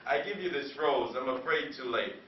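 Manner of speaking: spoken-word poem. Acted. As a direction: let the poem sink to a hushed ending. Quiet close of the poem.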